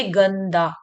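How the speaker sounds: "गंदा" slowly as gann-daa; half-n sound. Shown as a demonstration. Teacher letting pupils hear the half न, held n in "गंदा". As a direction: lean on a clear n in "गंदा". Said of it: "आधा ना आपको अपने आप ही".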